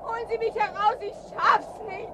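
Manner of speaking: high, strained begging; desperate plea. Acted. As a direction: plead to be pulled out. Trapped, begging for rescue.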